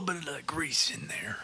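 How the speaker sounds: hushed savoring whisper. Pretend. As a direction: whisper about the grease.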